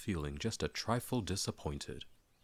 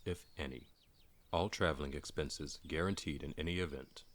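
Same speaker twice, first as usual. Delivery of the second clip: softer, measured official reading; formal letter read; 5 dB quieter than usual. Acted. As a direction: recite it like a stiff business letter. Reading the dwarves' note.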